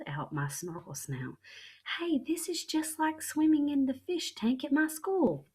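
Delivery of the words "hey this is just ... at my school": much higher, loud and thrilled; excited child. Acted. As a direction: burst out with delight. Excited child forgets to whisper.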